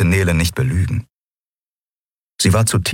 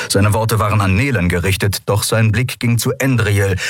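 usual speaking voice

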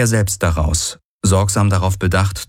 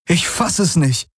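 higher, amazed disbelief; thrilled disbelief; 10 semitones higher than usual. Acted. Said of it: "begeistert von dem Anblick".

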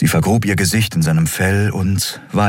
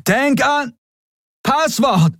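high yell through noise, in fragments; chopped, high-pitched distant call; broken-up distant shout; slower than usual. A narrator's reading. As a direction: shout scraps of words over the din. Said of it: "kam Kais Antwort zerstückelt durch den Lärm".

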